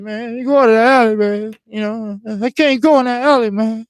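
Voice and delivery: about to break down; desperate pleading. Retold or imitated.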